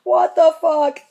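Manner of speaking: high, loud envious squeal; loud mock-jealous outcry. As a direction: fans who can't believe it. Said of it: "'Cause they're like".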